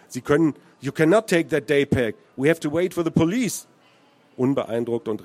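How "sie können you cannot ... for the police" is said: raised voice, switching into English; insistent objection. A narrator's reading. Teenager stopping the stranger taking the pack, insistent. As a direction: firmly stop him taking the bag. Teen blocks stranger, mixed languages.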